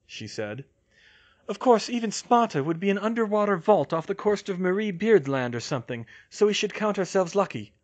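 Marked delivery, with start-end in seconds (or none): 1.5-7.7 high, loud know-it-all heroine; pitched-up voice, brisk and opinionated; Hermione, wry. Acted. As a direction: clever young witch speculating aloud.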